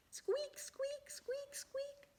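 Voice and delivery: tiny high whispered peeps; faint chick squeak. Acted. The chick squeaking inside the egg, tiny and faint. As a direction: breathe out faint little squeaks.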